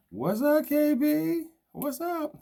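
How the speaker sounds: high, loud, delighted hello to a viewer; excited greeting. Real, not acted. Host hails viewers joining his live video, loud and high. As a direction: shout out a new arrival with joy.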